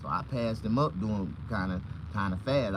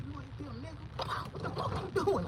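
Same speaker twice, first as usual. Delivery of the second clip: high mock cop; 6 dB quieter than usual. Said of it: "He said".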